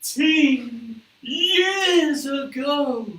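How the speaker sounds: slow, high and loud; dramatic slow reveal. Acted. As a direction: drag it out for drama.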